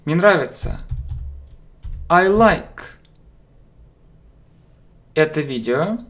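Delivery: ordinary delivery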